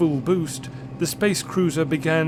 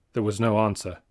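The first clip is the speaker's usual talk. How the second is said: ordinary delivery, nothing marked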